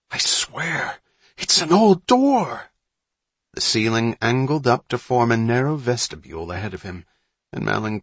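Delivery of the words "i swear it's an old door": high, drawn-out excited insistence; hushed-to-loud excitement. A narrator's reading. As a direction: build from breathless hush to loud amazement. Boy excited at finding door.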